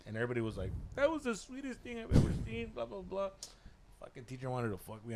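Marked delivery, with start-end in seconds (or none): 1.0-3.3 high-pitched mimicked admiration; mock gushing classmates. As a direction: gush in a put-on high voice. Mimics classmates gushing over his gesture.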